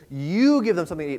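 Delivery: emphatic "You", then falling away; stress on YOU. Shown as a demonstration. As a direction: hit the word "You" to shift the responsibility. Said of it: "has an emphasis on the word "you".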